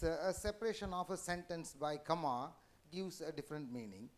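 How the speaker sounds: ordinary talk, nothing marked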